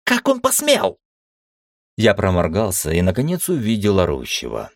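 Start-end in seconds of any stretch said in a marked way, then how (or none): 0.0-1.0 high furious shriek. Acted. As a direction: screech it in outrage. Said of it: "Вдруг завизжал он".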